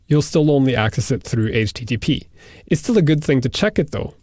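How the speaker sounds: normal talking voice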